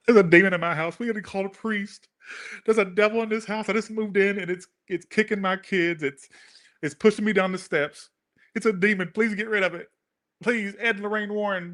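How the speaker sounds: high, pleading mock panic; panicked homeowners. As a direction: play the scared new homeowner begging for help. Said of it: "they go".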